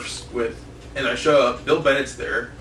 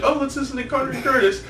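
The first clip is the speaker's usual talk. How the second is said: higher-pitched eager quote of a friend; imitating Zoe; 10 semitones higher than usual. Imitating Zoe picking a podcast on the drive, pitched up. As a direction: mimic her perky suggestion, pitched up.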